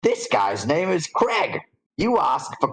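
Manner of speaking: slow, high character voice; puzzled note reader. Acted. Reader puzzles over Crag and Craig, high slow voice. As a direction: read aloud high and slow, then query it.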